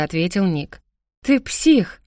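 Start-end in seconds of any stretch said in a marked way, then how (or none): 1.2-2.0 higher-pitched outburst, short of a full scream; narrated angry cry. A narrator's reading. Sister lashing out at her spying brother, angry cry. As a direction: cry out in anger, moderately raised. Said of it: "закричала я".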